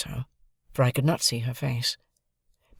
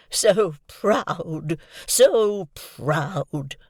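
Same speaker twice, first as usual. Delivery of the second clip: moaning; 4 semitones higher, 3 dB louder, much slower than usual. A narrator's reading. Havisham moaning at Estella's pride. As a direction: groan the words in grief. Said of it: "moaned Miss Havisham".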